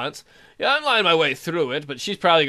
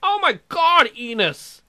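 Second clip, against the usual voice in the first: much higher and louder, disbelieving excitement; thrilled shout; 8 semitones higher, 4 dB louder than usual. Real, not acted. Player cheering that she went along with the kiss.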